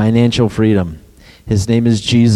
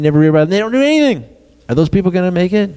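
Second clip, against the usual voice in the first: rising fast and high, heated; fervent build; 8 semitones higher, much faster than usual. Real, not acted. Preacher working the congregation into a fast, high fervor. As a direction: pile it on, faster and higher.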